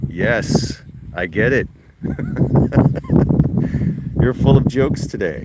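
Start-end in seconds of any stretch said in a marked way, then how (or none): 2.0-4.1 laugh. Real, not acted. Laughing at dog's joke.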